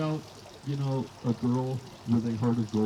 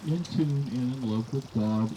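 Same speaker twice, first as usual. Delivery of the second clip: normal talking voice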